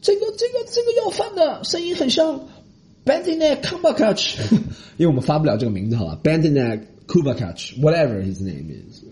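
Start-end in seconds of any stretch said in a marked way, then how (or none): 0.0-4.4 impressed gushing. Retold or imitated. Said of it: "然后别人会说".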